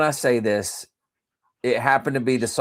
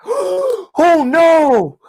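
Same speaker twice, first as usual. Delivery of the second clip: gasp, then high, loud mock dismay; hammed-up horrified outcry; imitated alarmed crowd; 6 dB louder than usual. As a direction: mimic onlookers crying out in shock. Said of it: "everyone was like".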